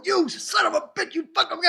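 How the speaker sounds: high-pitched retold exasperation; mock cursing. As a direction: curse a computer in comic frustration. Acts out cursing at the computer.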